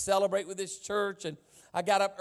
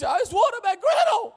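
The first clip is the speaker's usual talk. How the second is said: imitating yelling lady; 14 semitones higher, 6 dB louder than usual. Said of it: "And she was".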